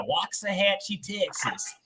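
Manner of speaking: a light put-on Texas country accent. Host recalling his old Texas home, mild mock country accent. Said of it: "with the country accent?".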